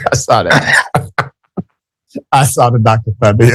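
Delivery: real laughter breaking into the words; laughing. Real, not acted. Host amused by the Doctor Thunder soda detail, laughing. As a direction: talk through your laughter.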